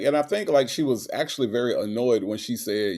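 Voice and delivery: normal talking voice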